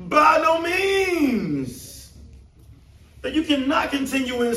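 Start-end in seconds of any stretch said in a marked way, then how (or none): 0.1-2.1 slow, high, loud proclamation; booming slow declaration; emphatic declaration. Retold or imitated. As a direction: thunder each word out. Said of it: "Paul uses this emphatic language".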